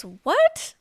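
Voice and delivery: high, loud mock-startled "what?"; taken aback. Husband hears his wife is going, startled. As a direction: imitate a husband caught off guard, pitch shooting up. Husband reacts to sudden announcement.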